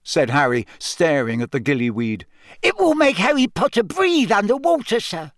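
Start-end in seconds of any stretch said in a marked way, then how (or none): 2.6-5.3 high squeaky elf voice; squeaky house-elf. A narrator's reading. Dobby voice.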